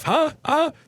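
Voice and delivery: high, teasing questioning huh. Real, not acted. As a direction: needle them playfully. Teasing the audience about the messages.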